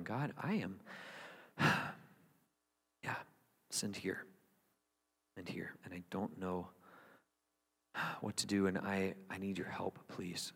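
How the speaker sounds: low, very soft, halting prayer; hushed confession. Retold or imitated. Preacher recalling own prayer to God, hushed confession. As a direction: confess it almost under your breath.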